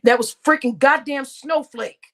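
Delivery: ordinary talk, nothing marked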